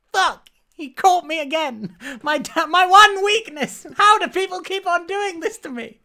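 very high, loud, hammed-up dismay; mock exasperation. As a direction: wail it like a thwarted melodrama heroine. Acted outburst in retold scene.

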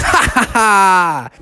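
slow hammed-up villain laugh; slow mock triumphant ha ha ha; gloating laugh. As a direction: cackle over the bad trade. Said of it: "and Bubby goes".

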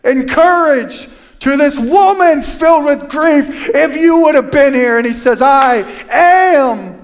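high, loud, fervent pulpit preaching; preaching at full force. Acted. Pastor preaching loudly to his church about Jesus.

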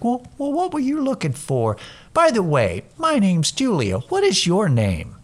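put-on high, swooping kids' show host; talking to a child. Counter woman introducing herself to a snippy customer, childlike sweetness. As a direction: sweetly introduce yourself as if to a little one. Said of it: "like she's talking to a child".

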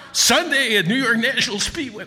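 shouted high monster-truck ad read; hyped radio ad announcer. Retold or imitated. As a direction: yell it like a Sunday speedway ad. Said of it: "I won't be doing anything like".

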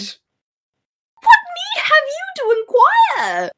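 slow, high, drawn-out shout of exasperation; exasperated shout. Acted. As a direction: bellow it like a man fed up with stupid questions. Reading Menaechmus answering the doctor.

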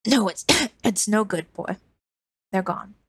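broken by coughs, weary; coughing old woman. Acted. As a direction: force the words out between coughs. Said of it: "through rattling coughs".

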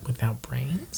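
very soft whispered question; hushed echo. Acted. Echoes the whispered horror.

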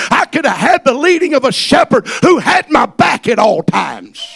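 loud, high-pitched preacher's cry; full-throated revival preaching; preaching at full force. Acted. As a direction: preach it to the rafters.

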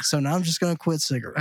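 normal talking voice

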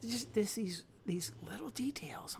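breathy hushed awe over tiny details; awed whisper. Retold or imitated. Gushing to a friend about the game, in an awed whisper. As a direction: whisper it like you're marveling.

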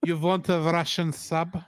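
slow, badly faked Russian accent; movie-quoting mock-Russian accent. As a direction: offer it in a hokey Russian accent. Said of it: "With a very bad Russian accent".

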